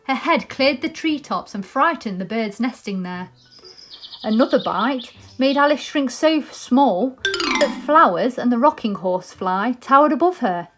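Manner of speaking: plain reading voice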